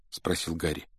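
usual speaking voice

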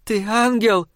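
high, hoarse woman's voice; hoarse croak. A narrator's reading. Dazed victim asking the boy beside her, hoarse high croak. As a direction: croak the question, pitched well up. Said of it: "прохрипела она".

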